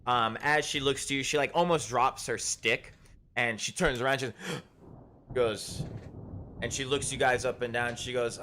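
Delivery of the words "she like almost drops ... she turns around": ordinary delivery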